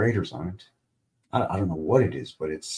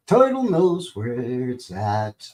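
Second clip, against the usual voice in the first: slow, drawn-out silly voice; slow goofy drawl; 3 dB louder, much slower than usual. Shown as a demonstration. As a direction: stretch every word out lazily. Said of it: "goofy voices".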